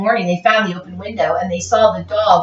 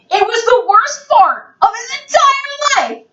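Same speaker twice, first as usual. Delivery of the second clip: much higher, loud cry; dramatic shout; 13 semitones higher, 4 dB louder than usual. A narrator's reading. Reading to a child at the climax, a loud dramatic cry. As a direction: belt it out as the big moment.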